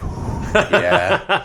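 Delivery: imitating a friend getting turned on; high, hammed-up swoon with a laugh; mock hot and bothered. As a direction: tease him with a pretend thrill. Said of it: "Scott's getting hot and bothered".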